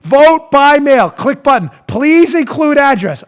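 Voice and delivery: loud, slow mock instructions; facetious, silly. Host reading voting website steps for clueless revolutionaries, slow silly readout. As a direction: read it out as if for dimwits. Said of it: "I'm being facetious here and silly".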